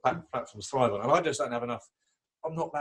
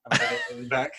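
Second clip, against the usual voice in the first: laughing; 4 dB louder than usual. Real, not acted. Laughing while recounting the reply.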